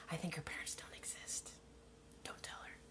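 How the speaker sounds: conspiratorial whisper. Real, not acted. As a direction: whisper it like a secret joke. Confiding joke aside.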